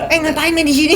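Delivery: lightly acted high-pitched scolding; scolding neighbour. Neighbour chases away someone loitering, mock high-pitched scolding. Acts neighbour driving him off.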